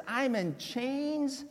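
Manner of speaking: soft, slow chains; slow soft lament. Acted. Pastor contrasting Paul's chains with joy. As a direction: sink into the words. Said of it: "you would think those words".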